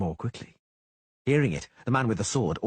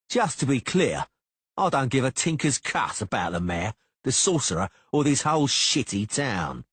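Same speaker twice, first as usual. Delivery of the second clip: higher, louder words each leaned on; slowly emphasizing words; 5 semitones higher, 4 dB louder than usual. A narrator's reading. Cicada taunting the Witcher, higher and louder with each word leaned on. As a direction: spell out each word as a threat. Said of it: "slowly emphasizing the words".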